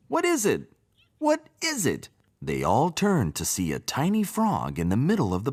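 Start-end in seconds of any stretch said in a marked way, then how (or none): 0.1-2.1 alarmed frogs. A narrator's reading. Frogs startled by terrible noise.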